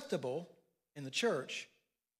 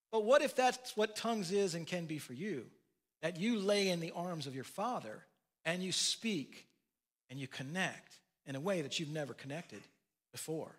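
ordinary talk

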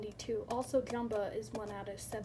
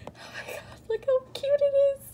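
excited squeal; 13 semitones higher, 7 dB louder, much faster than usual. Real, not acted. Gushing over new figure.

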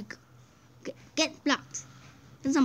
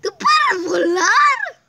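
laughing shout; 6 semitones higher, 8 dB louder, much slower than usual. Real, not acted. Laughing at ghost friend.

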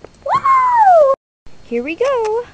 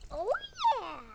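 ordinary delivery

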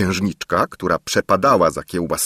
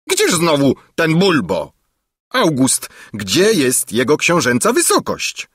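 higher, demanding royal questioning; impatient king; 8 semitones higher, 3 dB louder than usual. A narrator's reading. King demanding the prince's whereabouts from his valet, impatient. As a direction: call for the servant with impatience.

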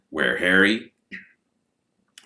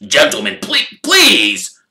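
higher, anxious plea, stumbling; crying out; 6 semitones higher, 7 dB louder, slower than usual. Acted. Shop assistant pleading with fighting customers, crying out. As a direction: beg the brawlers to stop. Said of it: "cried the assistant".

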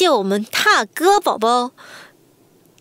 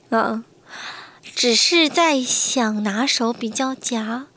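ordinary talk

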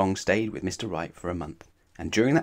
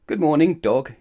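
bright, loud, higher good morning; cheery greeting; 6 semitones higher, 7 dB louder than usual. Acted. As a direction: greet him brightly and loudly.

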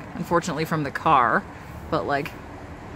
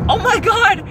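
startled oh my god; 14 semitones higher, 5 dB louder, slower than usual. Retold or imitated. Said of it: "And I was like".

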